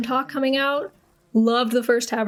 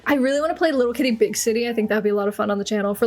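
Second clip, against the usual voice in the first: ordinary delivery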